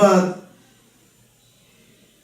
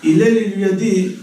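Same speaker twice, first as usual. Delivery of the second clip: normal talking voice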